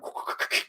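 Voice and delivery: quick breathy jump noise, no voice; imitated whoosh. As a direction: make a rough whooshing sound effect. Imitating a leap over the gap.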